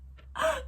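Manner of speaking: sharp high gasp of shock. Retold or imitated. Vlogger recalling the ending, a sharp high gasp. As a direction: gasp like the twist just hit.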